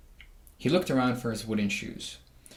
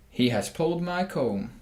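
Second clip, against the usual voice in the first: rooster gloating; 5 semitones higher, 3 dB louder than usual. Acted. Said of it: "crowed the rooster".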